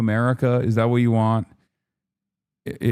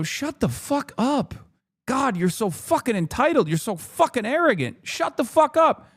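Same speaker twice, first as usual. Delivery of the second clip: much higher, pitched-up mock yell at an entitled lobby; fed-up yell; 12 semitones higher than usual. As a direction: snap it back high and exasperated like a fed-up average American. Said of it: "people see this and they go".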